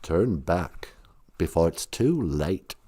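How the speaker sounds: low, breathy whispered warning; whispered Goblin King. Retold or imitated. Goblin King warning Sarah in a whisper.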